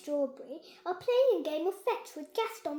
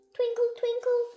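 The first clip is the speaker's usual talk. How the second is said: high, louder sing-song spell; magic spell; 9 semitones higher, 9 dB louder than usual. Acted. Holly waving her magic wand, sing-song.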